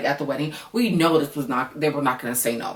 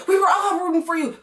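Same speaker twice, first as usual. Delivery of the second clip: high, louder mock disappointment; mock scolding; 13 semitones higher, 3 dB louder than usual. Telling Clay off for letting A D down, high and mocking. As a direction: scold Clay with sarcastic pity. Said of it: "to dumb Clay".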